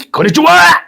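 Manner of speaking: high, loud cheery konnichiwa; shouted mock greeting; excited greeting. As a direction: burst out with a bright hello. Playful Japanese greeting shout.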